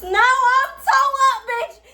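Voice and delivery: loud tipsy squeal; giggly, tipsy and loud. Real, not acted. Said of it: "I'm a little tipsy today".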